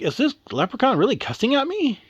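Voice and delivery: high-pitched, acted disbelief; incredulous. Sarah reacting to a cussing leprechaun, incredulous. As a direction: ask it as if you can't believe it. Girl can't believe the rudeness.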